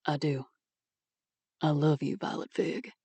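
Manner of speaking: soft, hushed confession of love; tender and quiet. Acted. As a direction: say it gently, almost under the breath. Lover declares love softly.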